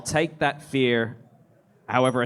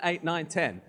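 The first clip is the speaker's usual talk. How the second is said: usual speaking voice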